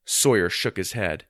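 ordinary delivery

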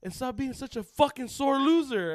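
loud, high mock tirade; imitated mad kid. Winning kid berates the kids who lost, loud imitated anger. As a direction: hurl it like a kid telling off a loser. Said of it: "that's how I talk when I'm mad".

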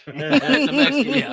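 put-on loud, slow drunk voice with laughter; drunk father-in-law. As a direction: mimic a tipsy slur. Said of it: "He just kept going".

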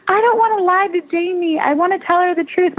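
a guilty friend begging to tell the truth; pleading friend. Retold or imitated. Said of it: "she's like".

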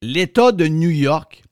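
slow, weighty delivery; slow and emphatic. Real, not acted. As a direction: spell it out slowly.

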